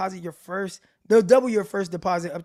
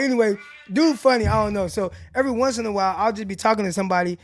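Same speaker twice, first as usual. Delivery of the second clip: usual speaking voice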